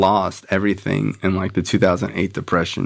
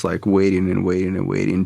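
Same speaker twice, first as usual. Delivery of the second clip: normal talking voice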